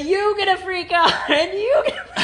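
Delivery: hint of an Oprah giveaway cheer, laughing; lightly voiced Oprah announcing prizes; Oprah impression. Host imagining Oprah beside the car factory, mock cheer. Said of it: "she will say".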